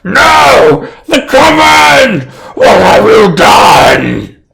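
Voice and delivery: very high, very loud drawn-out hag shriek; anguished screeching villain; Blister yelling out. Acted. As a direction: wail in horror at the top of your voice. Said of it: "Blister yells out".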